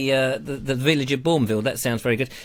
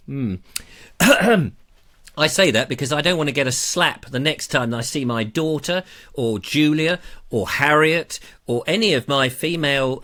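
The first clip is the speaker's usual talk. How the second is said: normal talking voice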